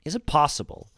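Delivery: can he really mean it; surprised. A narrator's reading. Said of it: "A slight movement of surprise".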